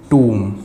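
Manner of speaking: टूम with a long oo, no b; tomb as toom. Shown as a demonstration. Teacher gives the right टूम, long oo. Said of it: "वो होता है".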